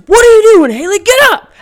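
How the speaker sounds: loud, high scolding shout; imitating dad yelling. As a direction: act out an exasperated dad hollering. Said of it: "My dad turns around and says".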